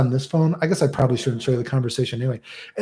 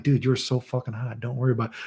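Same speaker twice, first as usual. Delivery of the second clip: casual reassurance; 8 dB quieter than usual. Retold or imitated. Streamer reassuring a nervous girl, soft and casual. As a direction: brush off the worry gently.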